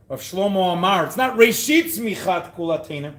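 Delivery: normal talking voice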